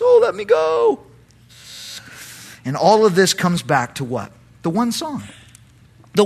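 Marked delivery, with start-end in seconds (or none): 0.0-1.0 very high, badly faked whine; feigned sadness. David pretending to Achish, feigned sadness. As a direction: plead in a hammed-up sad voice. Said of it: "feigns sadness".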